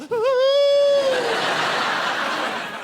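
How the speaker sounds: high, loud whooping cackle; a wild shrieking laugh; audience shriek. Real, not acted. Northern lady in the crowd, shrieking laugh. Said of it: "That was the call of the northern girl".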